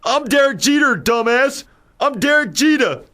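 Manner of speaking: high-pitched, hammed-up bad acting; mock indignant ballplayer, badly acted; Derek Jeter. Shot ballplayer protests to the cop, hammed-up bad acting. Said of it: "who can't act worth a lick".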